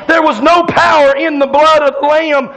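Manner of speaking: usual speaking voice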